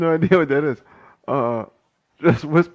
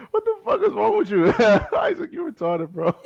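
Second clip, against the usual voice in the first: laughing. Real, not acted. Still laughing at friend.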